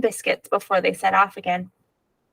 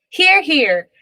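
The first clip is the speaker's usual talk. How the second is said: loud, higher cheer; calling out; 7 semitones higher, 5 dB louder than usual. A narrator's reading. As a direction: call it out with the table. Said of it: "everyone called".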